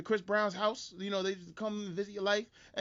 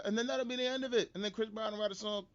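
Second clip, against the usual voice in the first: normal talking voice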